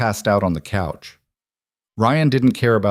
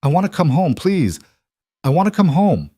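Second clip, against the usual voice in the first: tearful pleading; 9 semitones higher than usual. Acted. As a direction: plead to come home like a crying child. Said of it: "Lily called him crying".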